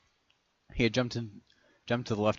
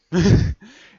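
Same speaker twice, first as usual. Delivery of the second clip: short laugh; 12 semitones higher, 13 dB louder than usual. Real, not acted. Laughs at own aside.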